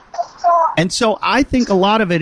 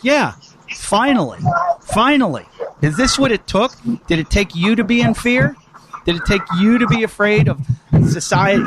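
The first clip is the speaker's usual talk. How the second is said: quoting himself; 7 semitones higher than usual. Retold or imitated. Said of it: "and I'm like".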